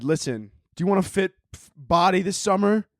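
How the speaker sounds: ordinary talk, nothing marked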